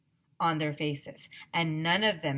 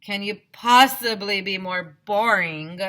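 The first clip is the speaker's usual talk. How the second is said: louder scornful jab; 3 semitones higher, 9 dB louder than usual. A narrator's reading. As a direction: roll your eyes at them. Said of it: "asked Alexia".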